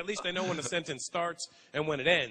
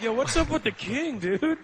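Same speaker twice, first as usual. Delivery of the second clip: mock confused; 7 semitones higher, 6 dB louder, slower than usual. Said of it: "everyone's like".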